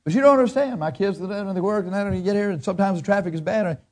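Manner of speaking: high pleading employee whine; mock worker pleading with the boss; whiny excuse. Mimics employee whining excuses.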